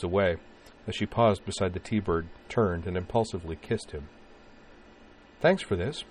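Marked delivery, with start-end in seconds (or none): 5.4-6.0 high, lightly flippant thanks; slightly forced flippancy. A narrator's reading. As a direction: toss it off like a joke that hides the truth. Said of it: "with an air of slightly forced flippancy".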